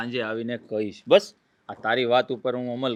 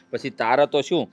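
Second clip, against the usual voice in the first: louder, insistent brother laying down the facts; raised voice; 3 semitones higher, 7 dB louder than usual. Acted. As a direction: push it out, firm and loud. Brother raises voice about duties.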